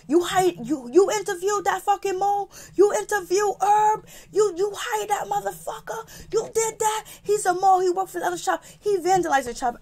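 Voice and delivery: very high, frantic mock screech; imitated screaming. Angry boss confronting Alex over a hire, high mock screaming. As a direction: mimic someone shrieking in disbelief without much volume. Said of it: "C's is yelling and screaming".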